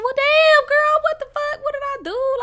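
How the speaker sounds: high, loud exasperated outburst; shocked. Retold or imitated. Talking to herself about the money that vanished, shrill disbelief. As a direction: yelp at yourself in disbelief.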